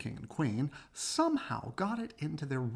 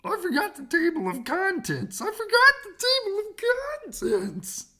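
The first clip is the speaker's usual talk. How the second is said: much higher, loud frantic cry; fretting narrator, rising and slowing; panicked narrator; 7 dB louder than usual. Acted. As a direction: blurt it out in panic. Narrator panics about missing contents.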